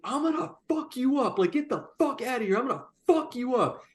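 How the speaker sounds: strained high threats, volume held back; imitated screaming. Owner blocking customer's way, imitated screaming. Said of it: "he's screaming at me".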